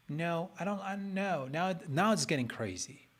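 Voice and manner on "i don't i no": usual speaking voice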